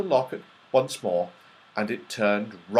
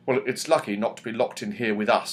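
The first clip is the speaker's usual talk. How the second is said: usual speaking voice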